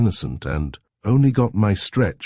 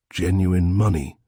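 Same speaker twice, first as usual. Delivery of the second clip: ordinary delivery, nothing marked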